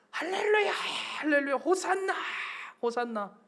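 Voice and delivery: high-pitched cheering of a welcoming crowd; crowd crying out. Retold or imitated. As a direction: imitate a jubilant crowd hailing the Messiah. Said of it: "수없이 외쳐요". (환호하며 외치는 군중 흉내)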